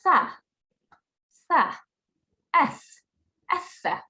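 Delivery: "Tha, tha, eth, etha" with th as in thin, spaced out; TH sound drill. Shown as a demonstration. Teacher drilling the letter for pupils, isolated th sounds. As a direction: model each "tha" and "eth" separately with audible air. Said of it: "It's a T H sound".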